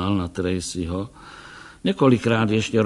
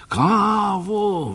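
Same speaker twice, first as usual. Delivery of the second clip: a sudden, higher cry of delight; exclaiming; 10 semitones higher than usual. A narrator's reading. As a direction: blurt out the word Kávu with joy. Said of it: "vykřikl doktor".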